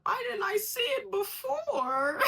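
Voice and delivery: high, loud, drawn-out lament; mock wail. Acting out somebody realizing they missed it, mock wail. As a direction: wail it slowly like a hammed-up victim.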